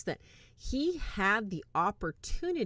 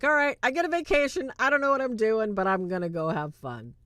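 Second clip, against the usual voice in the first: fast, higher and louder, carefree about a vacation; excited; 4 semitones higher, 6 dB louder than usual. Retold or imitated. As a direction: rattle it off like school just let out. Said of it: "He was so excited".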